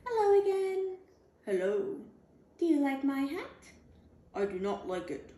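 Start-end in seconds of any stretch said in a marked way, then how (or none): 0.0-1.0 high and louder, sing-song greeting; the lady dog calls out hello. Acted. Hat dog greets the other dog. 2.6-3.4 higher, hopeful questioning voice; the lady dog shows off her hat. Acted. Hat dog asks about her hat.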